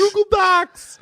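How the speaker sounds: very high, slow, strange Walken take; high squeaky Walken Google Docs; Walken impression. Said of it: "great bit where he goes".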